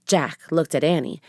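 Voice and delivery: plain reading voice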